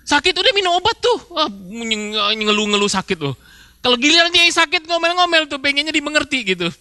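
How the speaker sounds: an irritated spouse mocking a partner's constant complaints; mocking grumbling spouse. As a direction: scoff at your whining partner.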